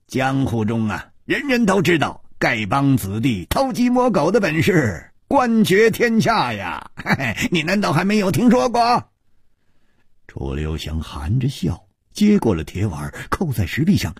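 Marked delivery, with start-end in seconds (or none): 0.1-9.1 chuckling boast. A narrator's reading. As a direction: brag with a cheeky laugh. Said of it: "歹毒行笑着说". (得意地笑着吹嘘)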